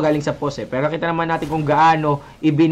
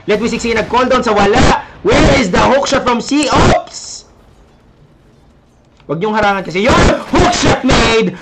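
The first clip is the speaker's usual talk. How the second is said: higher and louder, rapid hype calls; excited play-by-play; 6 semitones higher, 5 dB louder than usual. Real, not acted. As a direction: call the fight at full volume. Teamfight, caster hyped.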